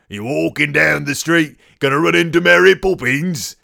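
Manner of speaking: put-on a touch of working English accent; lightly put-on English bricklayer. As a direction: play an English builder in the street, lightly. Voices a bricklayer in old England.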